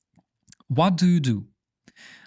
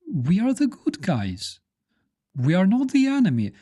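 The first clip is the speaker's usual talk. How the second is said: high, wide-eyed mock surprise; playing a fake-friendly hostile neighbour; mock innocent aggressor; 5 semitones higher than usual. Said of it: "the hostile country goes".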